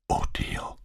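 breathless whispered gasp; shocked, airy whisper. A narrator's reading. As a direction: gasp it out almost without voice. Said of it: "japste sie".

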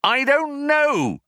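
high, slow yell; drawn-out high shout; yelled reply. A narrator's reading. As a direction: yell it back, stretching the words. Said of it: "yelled Ford".

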